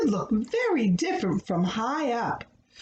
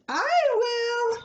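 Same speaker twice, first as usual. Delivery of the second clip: much higher, keen offer; eager; 12 semitones higher than usual. A narrator's reading. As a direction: jump in eagerly. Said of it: "said Paddington eagerly".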